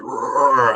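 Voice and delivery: mock roar of a charging dog; imitated dog growl. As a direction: growl it like a dog rushing downstairs. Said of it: "I hear this dog running down".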